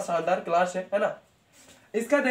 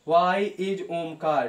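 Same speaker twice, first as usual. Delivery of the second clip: slow dictation; much slower than usual. Shown as a demonstration. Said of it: "teacher dictating".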